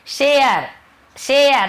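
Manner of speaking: "शेयर" stretched slowly as shay-ar; demonstrating vowel glide. Shown as a demonstration. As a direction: draw out "शेयर", high then falling. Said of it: "यहाँ पे ए ध्वनि निकल".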